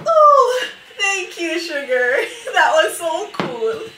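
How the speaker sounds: high, loud squeal of real delight, laughing; delighted thanks. Real, not acted. Baker thanking a live viewer mid-advice, excited and laughing. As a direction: burst out in delighted, giggly gratitude.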